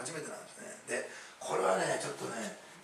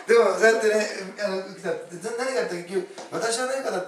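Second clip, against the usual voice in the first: loud, high, still laughing; real laughter spilling into speech; talking through laughter; 4 semitones higher, 10 dB louder than usual. Real, not acted. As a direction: carry on while the laugh subsides. Recovering from laughing at own joke.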